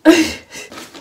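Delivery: loud burst of laughter. Real, not acted. Laugh after retort.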